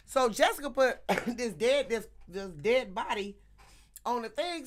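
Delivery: normal talking voice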